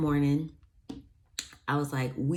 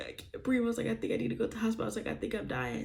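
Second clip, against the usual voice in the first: high, breathless panicked plea; 7 semitones higher, 5 dB quieter, much faster than usual. Retold or imitated. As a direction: gasp it out in panic. Retelling panic to mom.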